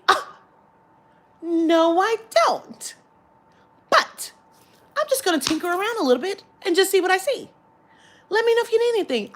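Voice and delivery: high, sing-song shop chat; hammed-up cheery customer exchange; effusive politeness. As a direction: answer with exaggerated sweetness. Acted sweet exchange with shop assistant.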